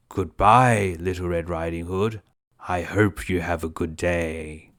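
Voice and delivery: sly wolf. Acted. Wolf sending the girl off, low and sly. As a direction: purr a fake-friendly goodbye. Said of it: "the wolf said".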